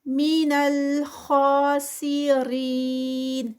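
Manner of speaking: من الخاسرین slow, with a heavy خا held for two counts; Quran recitation. Shown as a demonstration. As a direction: chant من الخاسرین with a heavy stretched خا. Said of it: "ٹو counts اور ساتھ میں heavy".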